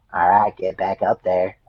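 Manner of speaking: put-on slow, lightly drawn-out Pennsylvania coach accent; a hint of a Pennsylvania drawl, unhurried. Coach ordering exhausted freshman back onto treadmill, mild Pennsylvania drawl. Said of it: "Pennsylvania Southern draw".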